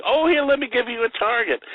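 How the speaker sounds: high-pitched eager mock offer; imitated clowning Zero Mostel. Zero kidding around with Jack's prop gun, high teasing. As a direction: pipe up high, goading playfully.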